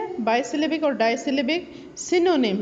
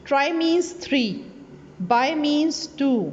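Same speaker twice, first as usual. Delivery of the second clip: slow teaching; 2 semitones higher, much slower than usual. Shown as a demonstration. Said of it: "teacher explaining prefixes".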